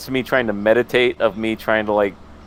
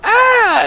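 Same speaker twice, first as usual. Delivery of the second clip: sudden high mock scream; startled scream while driving in the rain; 7 dB louder than usual. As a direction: shriek at the thing on the road. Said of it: "you're like".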